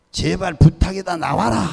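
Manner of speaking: insistent high-pitched prayer; pleading command. Retold or imitated. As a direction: beg forcefully for it to come out. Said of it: "부탁한다".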